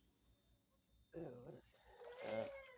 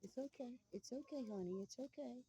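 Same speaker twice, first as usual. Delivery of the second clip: high soft reassuring; soothing a scared animal; 10 semitones higher, 4 dB quieter than usual. Real, not acted. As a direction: hush a frightened pet gently. Calming a startled animal.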